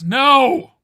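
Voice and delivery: big high objection; emphatic refusal. Real, not acted. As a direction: shoot it down loudly. Rejecting the added S loudly.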